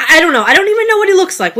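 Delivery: high, loud, fast panicky answer; cornered wife's flustered, loud denial. Acted. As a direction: rush the words out, cornered. Wife cornered during interrogation.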